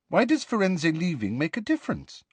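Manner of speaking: genuinely wants to know; mild curious lift. Acted. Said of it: "asked Hermione curiously".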